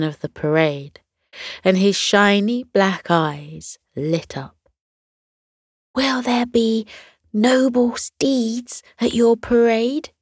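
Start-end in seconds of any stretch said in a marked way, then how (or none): 5.9-10.1 higher, airy breathless question; little dinosaur trailing into a breathy whisper. A narrator's reading. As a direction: ask in excited awe, almost no breath. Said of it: "asked breathlessly".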